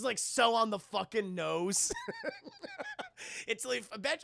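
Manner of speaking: ordinary talk, nothing marked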